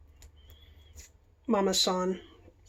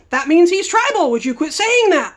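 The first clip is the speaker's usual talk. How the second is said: higher, louder exasperated scold; mock scolding clerk; 8 semitones higher, 6 dB louder than usual. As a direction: tell her off, exasperated.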